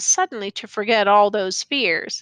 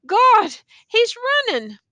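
high, loud alarmed cry; tattered man cries out as the soldier runs; shrill outcry; 11 semitones higher, 4 dB louder than usual. A narrator's reading. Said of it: "a shrill outcry".

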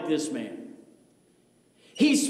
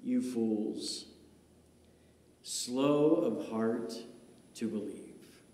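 quiet rebuke; 11 semitones lower, 9 dB quieter, slower than usual. Acted. Jesus quote, quiet.

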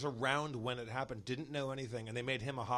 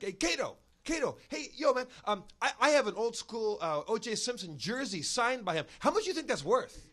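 high, louder, eager fan voice; pushy fan hustling for cash; eager stranger; 8 semitones higher, 6 dB louder than usual. Retold or imitated. A stranger at the diner asks Kato the jersey price, high and eager. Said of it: "a guy comes over to the table and goes like".

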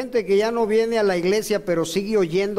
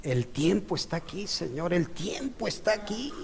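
oración susurrada; 8 dB quieter than usual. Retold or imitated. Relata su oración al Señor.

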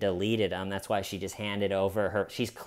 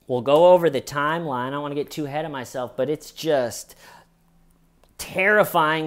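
usual speaking voice

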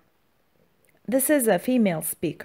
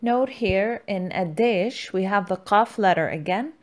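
ordinary delivery

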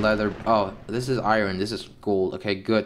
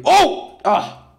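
startled yelp; 6 dB louder than usual. Real, not acted. Sudden near-death in game.

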